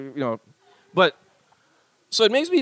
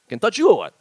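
Hawaiian pidgin, higher and punchy; playful pidgin pickup delivery; pidgin pickup line; 4 semitones higher, 5 dB louder than usual. Acted. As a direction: put on a pidgin come-on. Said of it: "like pidgin pickup lines".

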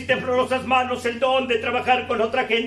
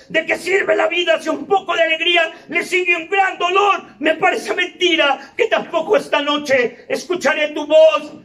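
ordinary talk, nothing marked

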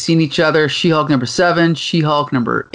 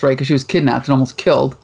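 usual speaking voice

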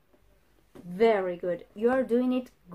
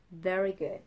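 usual speaking voice

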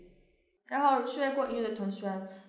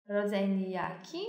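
ordinary talk, nothing marked

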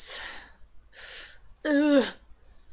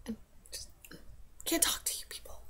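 mock upset; 3 semitones higher, 14 dB quieter, faster than usual. Vlogger feigning hurt at silent viewers, mock upset. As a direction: pretend hurt, trailing into a whisper. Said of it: "very upset with you people".

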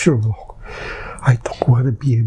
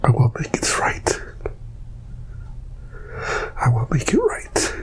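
whispered vow; 6 dB quieter than usual. Real, not acted. Quiet resolve.

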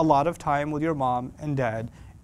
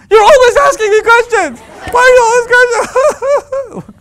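hammed-up, high-pitched screaming teenage meltdown; explosive outburst; 15 dB louder than usual. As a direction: blow up at mom like lit dynamite. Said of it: "Where did that explosion come from".

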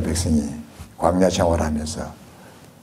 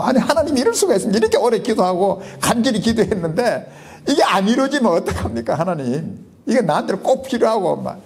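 much higher, fast, whining plea to God; complaining prayer; 12 semitones higher than usual. Retold or imitated. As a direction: pour out a grievance, half laughing at yourself. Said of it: "막 이런 생각으로 기도를 했죠".

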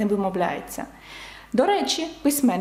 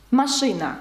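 normal talking voice

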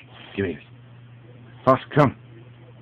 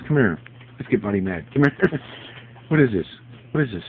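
normal talking voice

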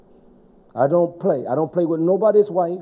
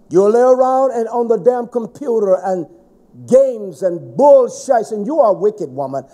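angry scolding; 5 semitones higher, 6 dB louder than usual. Real, not acted. Preacher scolding lazy congregation.

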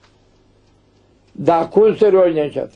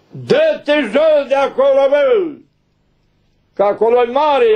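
put-on high, sharp rebuke from an old elder; scolding elder; 10 semitones higher than usual. Elder rebukes a monk about his prayer, high scolding shout. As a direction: bark him down off that ledge, slow at first. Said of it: "Îi spune unul".

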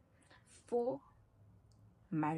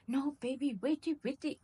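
mock pleading boyfriend; 6 semitones higher than usual.

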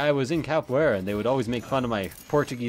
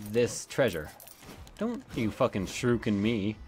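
ordinary talk

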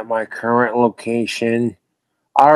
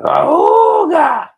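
put-on honking "Auga!" much higher and louder, like an old car horn; Model T horn imitation; 4 dB louder than usual. Streamer imitating his phone's ringtone, honking horn. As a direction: blast it out like a vintage car horn. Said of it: "That Model T horn ringtone".

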